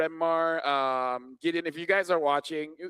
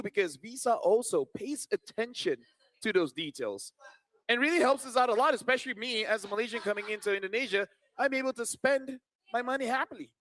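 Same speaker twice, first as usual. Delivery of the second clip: quick, bright sponsor read; 4 semitones higher than usual. Acted. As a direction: sell it like a commercial. Reads a sponsor ad.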